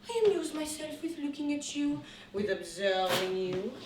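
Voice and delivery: normal talking voice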